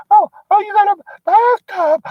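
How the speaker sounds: very high falsetto delight; high-pitched mock girlfriend gasping at the view; gushing girlfriend. Wife voiced gushing over apartment.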